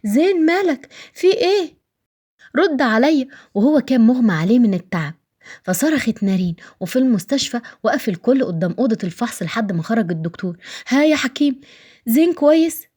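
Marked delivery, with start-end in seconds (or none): 0.0-3.3 high-pitched, panicked questioning; alarmed. Acted. Wife finding her husband collapsing, alarmed high voice. 10.9-12.9 high-pitched worried questions; anxious. Acted. Relative asking the doctor after Zein, anxious high voice.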